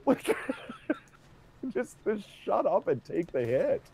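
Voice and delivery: high, loud laughing outburst; laughing disbelief. Real, not acted. Incredulous reaction, laughing.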